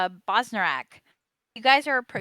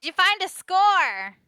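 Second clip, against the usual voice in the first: calling out louder; 10 semitones higher, 6 dB louder than usual. Acted. Repeats louder, parent didn't hear.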